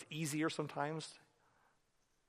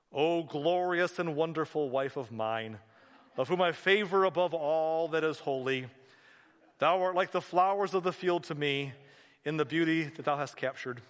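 grandiose love recitation; 2 semitones higher, 5 dB louder than usual. Acted. Husband reading a prepared speech to his wife, grandiose recitation. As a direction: intone it like stiff, archaic verse.

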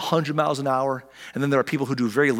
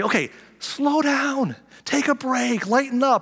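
higher, slow coaxing; mock soothing; 11 semitones higher, slower than usual. Pastor acting himself calming busy people, higher voice. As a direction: ease them down, high and slow. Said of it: "you'll say".

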